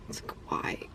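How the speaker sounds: exasperated whisper; whispered why. Real, not acted. Vlogger fed up with the bad movie, whispered why. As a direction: breathe the question out in disbelief.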